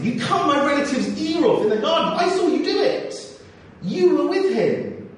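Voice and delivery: a relative who saw it all, pointing him out; indignant accuser. Acted.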